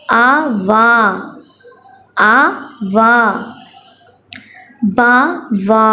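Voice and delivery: slow syllables with long pauses; teacher reading for children to echo; phonics reading drill. Shown as a demonstration. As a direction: read slowly so children repeat behind you. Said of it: "चलिए मैं पढ़ती हूँ".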